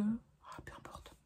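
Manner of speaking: whispered aside, barely voiced; chuchoté. Real, not acted. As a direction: breathe it out as a throwaway. Aside after forgetting a word. (murmure pour soi, chuchoté)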